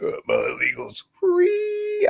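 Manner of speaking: very high, slow falsetto; ditzy girl voice. Pretend. Mocking the TikTok girl after laughing. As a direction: keep the silly girl voice going.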